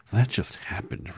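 Dorothy simply telling Oz it just happened; simple answer. Acted. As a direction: say it plainly like a child. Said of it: "returned Dorothy simply".

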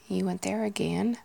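lilting Northern Irish question, rising at the end; Northern Irish accent. Shown as a demonstration. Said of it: "with the Northern Irish".

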